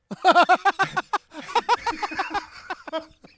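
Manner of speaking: loud high cackling laugh; real helpless laugh; big laugh. Real, not acted. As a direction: burst out laughing hard. Laughing at the story.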